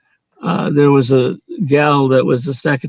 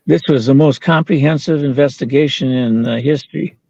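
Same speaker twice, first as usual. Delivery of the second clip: usual speaking voice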